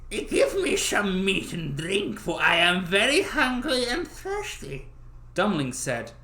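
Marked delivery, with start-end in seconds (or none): 0.1-4.9 put-on higher, slow pleading old man; old beggar voice. Little old man asking Dummling for food. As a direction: beg like the hungry little man.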